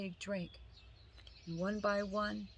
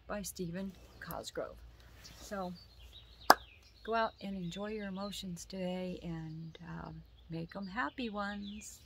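usual speaking voice

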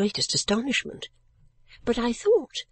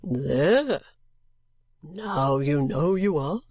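lower, slow, gruffly triumphant; gruff gentleman; 4 semitones lower, much slower than usual. Acted. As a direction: prove your point, low and slow.

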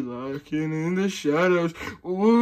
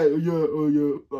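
normal talking voice

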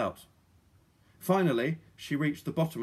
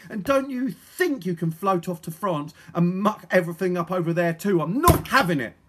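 put-on raised, forceful young heroine; stern; 4 semitones higher, 7 dB louder than usual. A narrator's reading. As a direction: tell them off firmly. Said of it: "said Polly sternly".

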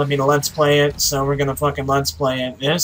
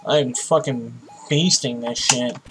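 ordinary talk, nothing marked

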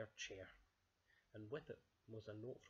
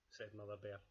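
ordinary delivery

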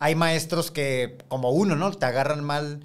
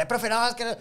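mock student protest; 8 semitones higher than usual. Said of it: "la agarraba".

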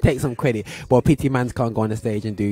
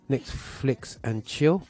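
drawn-out answer; 2 semitones higher, much slower than usual. Retold or imitated. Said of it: "And you're like".

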